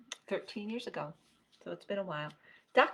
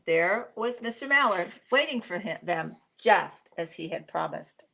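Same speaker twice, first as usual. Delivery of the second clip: lively picture-book narration with key words stressed; reading to children; 10 dB louder than usual. A narrator's reading. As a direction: read it like story time, pausing between phrases.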